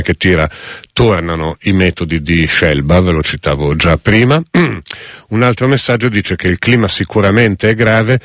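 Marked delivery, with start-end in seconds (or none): 4.4-4.9 sharp throat clearing cough. Real, not acted. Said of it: "Scusate la tosse".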